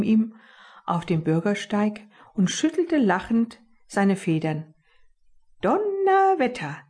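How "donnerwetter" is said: impressed exclamation, pitched up; a big appreciative wow; acknowledging the daring stunt with admiration; admiring. Acted. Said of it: "sagte er anerkennend".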